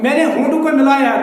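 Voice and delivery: ordinary delivery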